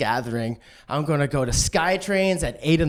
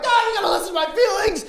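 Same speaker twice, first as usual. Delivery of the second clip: much higher, fast frantic pleading; crazy emotional rant; 13 semitones higher than usual. Retold or imitated. Boyfriend yells feelings at girlfriend, high frantic rant. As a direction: rant at her like you've lost it. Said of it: "then I seem like a crazy person".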